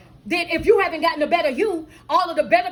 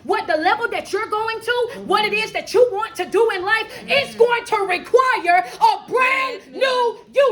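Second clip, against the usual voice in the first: higher-pitched, driving sermon delivery; fervent preaching; 4 semitones higher than usual. Real, not acted. Coach preaching that life requires a brand new you, fervent.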